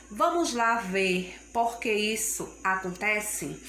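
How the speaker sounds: ordinary delivery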